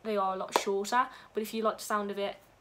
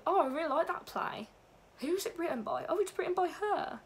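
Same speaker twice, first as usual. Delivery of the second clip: higher-pitched, mock-curious beginner's voice; keen discovery; 8 semitones higher than usual. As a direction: play someone pleasantly discovering a playwright, pitched up. Acts a beginner discovering a play.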